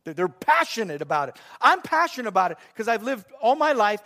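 ordinary talk, nothing marked

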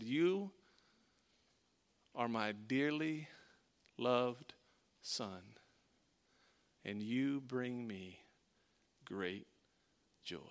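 God tenderly speaking to his beloved son. Acted. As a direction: speak it gently like a loving father. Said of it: "He said".

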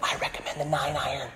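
hushed caddy's advice, softly spoken; golf caddy. Acted. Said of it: "like a ga-, a golf caddy".

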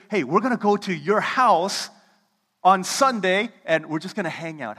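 loud, high, eager call; a friend bursting with excitement; excited invitation. Retold or imitated. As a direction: announce it like big news. Excited friend invites you over.

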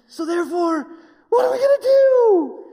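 hammed-up frantic shout of alarm; mocking the church's panic as a shrill outcry. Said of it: "everybody thinks".

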